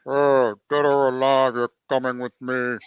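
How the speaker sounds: slow deadpan robot cop; RoboCop impression. Shown as a demonstration. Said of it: "that feels like a reasonable impression".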